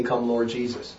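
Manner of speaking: quiet reverent repeat; hushed. Shown as a demonstration. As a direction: let "Come, Lord Jesus" fall to a near hush. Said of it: "What does that imply when we say".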